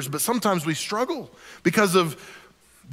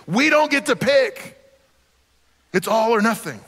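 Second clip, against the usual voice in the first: forceful; 5 semitones higher, 3 dB louder than usual. Real, not acted. Preacher rebuking pick-and-choose faith, forceful. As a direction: drive the point home hard.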